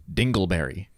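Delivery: deadpan, dead-serious answer; confident answer. Retold or imitated. Fiancée answering the icebreaker dead serious. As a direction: say it with total confidence. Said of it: "In all seriousness and unbridled confidence".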